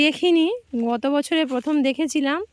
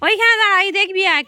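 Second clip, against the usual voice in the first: calling out; 6 semitones higher, 6 dB louder, faster than usual. Real, not acted. Calling child over outdoors.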